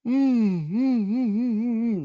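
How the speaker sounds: long high humming yawn. Real, not acted. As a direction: let a sleepy yawn hum out. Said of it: "Now I yawned".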